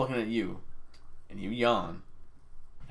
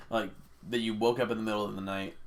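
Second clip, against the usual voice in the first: ordinary delivery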